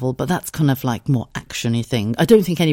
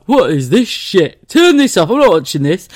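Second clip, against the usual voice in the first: louder, higher mock outrage at the telly; mock disgruntled husband; 4 semitones higher, 7 dB louder than usual. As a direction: complain loudly, imitating an annoyed husband. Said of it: "Scott came and sat with me, he'd be like".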